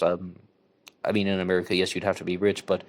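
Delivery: ordinary delivery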